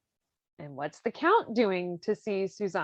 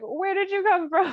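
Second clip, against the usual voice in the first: high, louder mock surprise; mock startled; 10 semitones higher, 4 dB louder than usual. Music teacher startled by the count, mock surprise. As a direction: act the music teacher caught off guard. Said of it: "Don Basilio is like".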